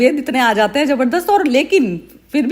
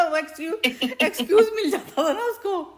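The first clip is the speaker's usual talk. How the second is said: high, soft words through giggles; real laughter breaking up the words; laughing; 5 semitones higher, 7 dB quieter than usual. Real, not acted. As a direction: giggle helplessly while talking. Amused by the mother-in-law tale.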